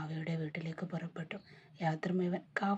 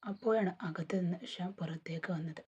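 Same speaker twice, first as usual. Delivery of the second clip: usual speaking voice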